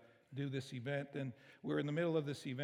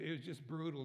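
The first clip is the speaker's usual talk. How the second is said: ordinary delivery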